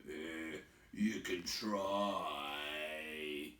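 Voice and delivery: low, very slow troll drawl; lazy troll. Acted. Bert picking up his skewer, slow low troll. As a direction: Bert shrugging it off. Bert's voice.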